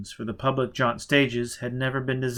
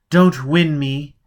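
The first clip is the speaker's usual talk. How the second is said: fierce plea; 4 semitones higher, 10 dB louder, slower than usual. Acted. Passionate plea to him.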